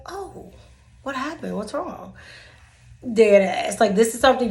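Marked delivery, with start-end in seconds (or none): 0.1-2.1 mock innocent. Playing innocent with the coworker.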